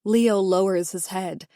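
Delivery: plain reading voice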